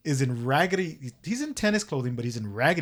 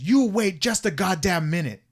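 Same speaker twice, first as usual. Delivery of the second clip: higher, louder imitation of an irate man; angry rebuke; 5 semitones higher, 6 dB louder than usual. Retelling old man rebuking the phone's owner, angry. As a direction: push back loudly, mimicking his outrage.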